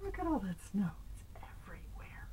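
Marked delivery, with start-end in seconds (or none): 1.2-2.3 soft, breathless whisper of wonder; awed whisper. Acted. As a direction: whisper it in awe. Awed aside about the snow.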